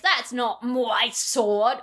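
very slow, dopey drawled line; imitating Kay. Kay refusing the sword in a slow dopey voice. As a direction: drag it out like a dim bully. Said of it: "Kay's like".

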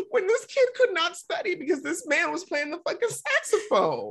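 very high, squeezed through laughter; laughing outrage. Real, not acted. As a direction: protest while cracking up.

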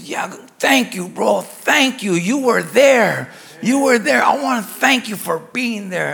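slow, emphatic gratitude, imitated; heartfelt thanks. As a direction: thank him emphatically, building in force. Patient thanks him, acted out.

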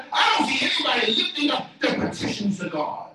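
high, loud sermon delivery; animated preaching. Real, not acted. Preacher answering sceptical curriculum readers, loud animated preaching. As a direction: press on loudly through the imagined objections.

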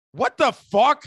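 mock shocked outburst; retold disbelief. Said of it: "I'm just like".

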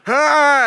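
a short high mock scream; hammed-up cry of fright. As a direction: yelp it out in pretend panic. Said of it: "And I'm like".

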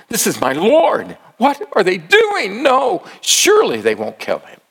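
high, anguished protest; acted alarm, voice rising sharply; distressed disbelief. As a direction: plead in disbelief, pitch leaping up. Mary acted watching her Lord condemned.

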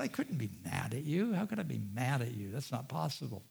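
soft, tender reassurance; gentle reassurance. Retold or imitated. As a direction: reassure him gently, softly. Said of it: "I said".

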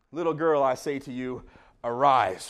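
tender daddy whisper-close call; tender, like to a daughter. Shown as a demonstration. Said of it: "he calls her with a term of endearment".

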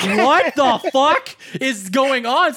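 high, slow, drawn-out laughing question; laughing disbelief. Retold or imitated. Caller cracking up at brother's rant, laughing disbelief. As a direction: laugh through your disbelief, retold. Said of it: "So I'm laughing".

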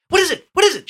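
loud high frantic cry. A narrator's reading. Mother bursting in on the screaming child, loud alarmed cry. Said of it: "she cried".

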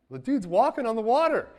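excited. Real, not acted. Preacher marvels at miracle.

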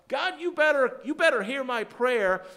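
loud, high-pitched demand to God; mock an insistent prayer, voice pushed up and loud. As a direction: act out an entitled, demanding cry. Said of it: "not crying out ... It's not like that. He's not making a demand of God".

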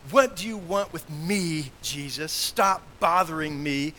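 loud, slow, heavily stressed defiance; acted contempt. Preacher voicing demon confronting Jesus, loud slow contempt. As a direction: push each phrase out as a contemptuous demand. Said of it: "You can almost hear the contempt in his voice".

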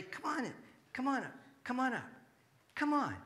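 high-pitched, eager beckoning; urging invitation. Acted. Acting out calling somebody over, high and urging. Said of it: "If you were to say to somebody".

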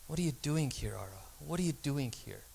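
soft, probing question; hushed divine question; quiet voice of God. Retold or imitated. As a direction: ask it gently, searching. Voice of the Lord to Ara.

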